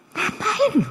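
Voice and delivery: high-pitched incredulous question; mock bafflement. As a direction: react as if baffled by odd gesturing. Mock reaction to odd gesturing.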